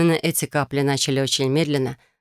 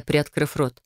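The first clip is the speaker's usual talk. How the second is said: ordinary delivery, nothing marked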